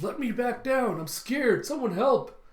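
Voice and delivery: put-on high, loud panicked child voice; Momonosuke freaking out. Captured child high in the air, imitated panicked pleading. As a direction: imitate a scared kid crying for help. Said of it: "You have Momonosuke freaking out".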